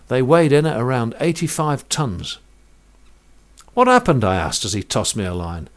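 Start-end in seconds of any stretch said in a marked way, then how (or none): 3.7-4.2 surprised. Retold or imitated. Asking harbour master.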